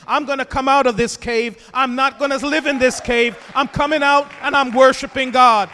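loud building preacher's cry; rising preached vow. Acted. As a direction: build it up, declaring David's resolve. Preacher voicing David's vow.

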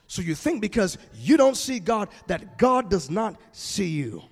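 ordinary talk

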